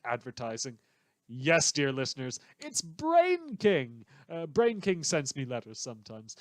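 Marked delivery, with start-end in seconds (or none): 2.6-4.0 high, slow, drawn-out showman reveal; grand announcement. Acted. Radio host unveiling a villain's letter, showman flourish. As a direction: stretch out the name like a game-show host.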